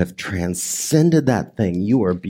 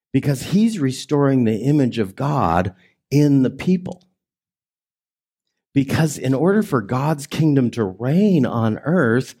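usual speaking voice

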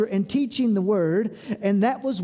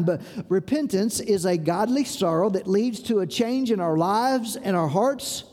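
ordinary talk, nothing marked

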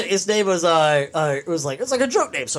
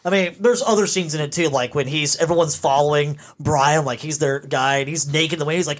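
ordinary talk, nothing marked

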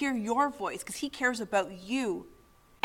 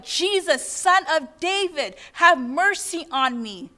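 raised, higher cry for mercy; 4 semitones higher, 4 dB louder, slower than usual. Retold or imitated. Blind man to Jesus of Nazareth, retold as crying out. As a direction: call out slowly, pleading to be heard. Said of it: "When he cries out".

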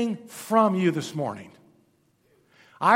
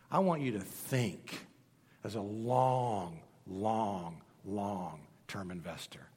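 lower, softer, slow long long; hushed appeal; 11 semitones lower, 10 dB quieter, slower than usual. Real, not acted. Preacher pleading with his congregation, hushed and slow. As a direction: draw out each long gently.

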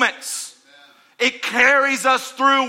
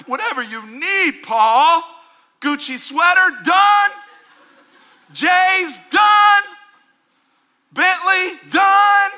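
loud, higher, granting every wish; generous God voice; 9 semitones higher, 6 dB louder, slower than usual. Pretend. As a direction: hand it all out like an eager genie. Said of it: "I'm up in heaven like".

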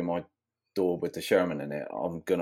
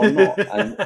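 laughing; 11 dB louder than usual. Real, not acted. Laughing at own retort.

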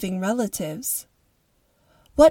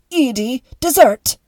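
raised, commanding call for service; 4 semitones higher, 7 dB louder than usual. Acted. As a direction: call Edie in sharply, louder and higher. Calls the servant for dessert.

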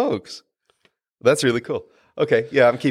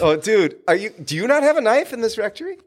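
incredulous; 8 semitones higher than usual. Real, not acted. Incredulous question.

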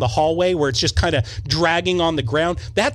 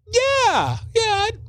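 high squeaky yeah; much slower than usual. Retold or imitated. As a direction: voice cracks way up into a squeak. Scared high squeak of agreement.

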